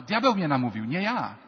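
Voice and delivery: loud blame-shifting protest; hammed-up plea of innocence; mock defensive denial. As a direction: play Eve passing the blame, emphatically. Acts Eve blaming the devil.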